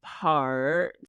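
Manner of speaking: slow, stretched-out words; slow motion. Pretend. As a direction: drag each word out slowly. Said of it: "Talking in slow-mo is crazy".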